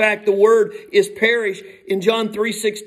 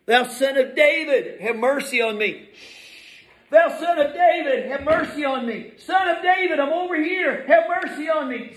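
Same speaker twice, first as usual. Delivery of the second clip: high, urgent calling plea; crying out; 7 semitones higher than usual. Acted. Blind man calling to Jesus over the crowd, crying out. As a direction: cry out to be noticed, climbing much higher. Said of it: "cried the more".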